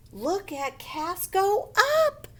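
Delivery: high, excited cheer of an onlooker. Acted. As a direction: exclaim it high, stretching to UP. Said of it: "They're excited".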